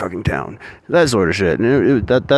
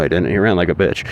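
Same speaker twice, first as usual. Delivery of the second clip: normal talking voice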